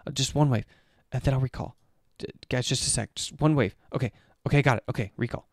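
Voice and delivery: fast, rushed imitation of a greedy player; hurried mock player. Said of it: "Jhin is like".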